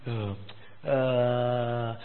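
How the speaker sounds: low, drawn-out hemming; reluctant hesitation. Acted. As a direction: stall as if put on the spot. Reluctant member asked to host.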